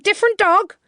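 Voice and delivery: "Different dog?" high and loud, alarmed; alarmed echo. A narrator's reading. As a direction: snap back "Different dog?" in shock. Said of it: "he cried".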